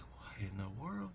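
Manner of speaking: hushed, breath-only whisper of astonishment; whispered disbelief. Retold or imitated. Man retelling his reaction to the praying stranger, whispered disbelief. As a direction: whisper it, as if stunned under your breath.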